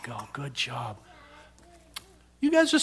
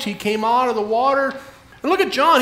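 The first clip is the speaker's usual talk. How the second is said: ordinary talk, nothing marked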